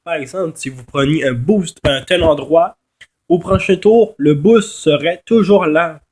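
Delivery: ordinary delivery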